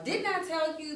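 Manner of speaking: high-pitched told-you-so; exasperated parent. Retold or imitated. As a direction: nag like a fed-up parent. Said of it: "Like, you know".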